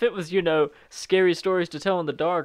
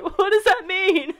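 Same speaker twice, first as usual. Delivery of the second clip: high, loud, slow baffled cry; hammed-up confused wail; mock bewilderment; 7 dB louder, slower than usual. Acting bewildered haunted person.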